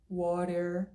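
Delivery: WATER with tapped T and strong final R; slow Californian WATER, R sounded; American R. Shown as a demonstration. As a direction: pull the R at the end of WATER. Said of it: "Você pode pronunciar".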